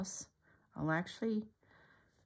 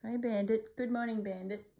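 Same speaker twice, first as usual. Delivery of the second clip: pet voice; 6 semitones higher than usual. Real, not acted. Greeting the dog Bandit.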